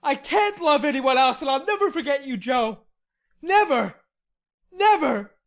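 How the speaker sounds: loud, high vow, stamping each Never; passionate. A narrator's reading. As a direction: swear it with a stamp. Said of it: "with a stamp to emphasize his passionate words".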